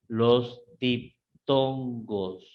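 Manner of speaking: "diptongos" broken slowly into dip-ton-gos; slow, syllable by syllable. Shown as a demonstration. Teacher naming diphthongs for the class, slow and syllabified. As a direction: draw out each syllable of "diptongos", with small gaps.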